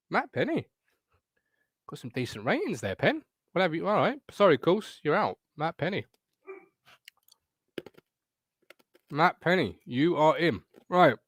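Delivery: talking while crunching chips; munching between words. Real, not acted. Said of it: "Excuse my crunching".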